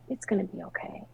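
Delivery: softly voiced comforting sister; hushed words of comfort, acted out; gentle reassurance. Sister consoling her in retold scene.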